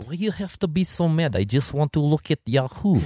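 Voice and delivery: mock Russian accent; thick put-on Russian accent. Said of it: "I had my Russian accent down".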